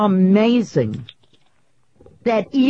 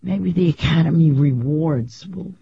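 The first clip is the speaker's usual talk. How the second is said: normal talking voice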